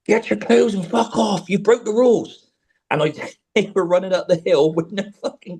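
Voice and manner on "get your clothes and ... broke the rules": retold shout. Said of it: "And I went".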